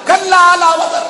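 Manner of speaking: high, loud, drawn-out verse; ringing Quranic refusal; emphatic recitation. Acted. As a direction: proclaim it slowly at full voice. Preacher repeats the verse's denial.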